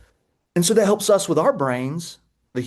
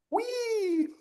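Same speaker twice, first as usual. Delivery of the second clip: high squealed whee; gleeful whee; 8 dB quieter than usual. Real, not acted. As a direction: squeal with joy riding the chair. Rolling on a rolly chair.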